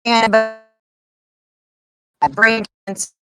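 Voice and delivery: breaking up on a bad line; glitchy Wi-Fi audio; choppy connection. Real, not acted. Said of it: "Your Wi-Fi is breaking up".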